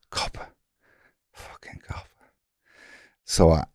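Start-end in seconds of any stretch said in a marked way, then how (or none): 0.1-2.1 unvoiced whisper, realising she's police; whispered curse. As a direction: hiss it under your breath, bitter. Silent suspicious thought.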